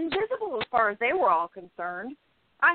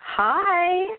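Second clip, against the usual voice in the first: sarcastic greeting; 6 semitones higher, 6 dB louder than usual. Acted. Tommy barges in uninvited.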